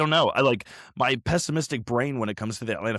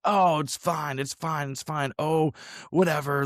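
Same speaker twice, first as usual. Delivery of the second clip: higher-pitched mock nonchalance; breezy dismissal; 6 semitones higher than usual. Imitating fans shrugging off the first hire, breezy dismissal. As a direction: wave it away in a lifted voice. Acts out everyone brushing it off.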